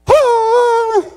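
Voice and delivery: high, loud martial-arts style scream; sudden piercing attack cry; fighting yell. Retold or imitated. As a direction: let out a long, high battle shriek. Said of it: "he did something like this".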